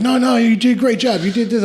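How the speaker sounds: high, loud gushing encouragement; insistent, high-pitched reassurance; mock reassurance. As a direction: imitate a partner piling on praise. Imitates partner's reassuring praise.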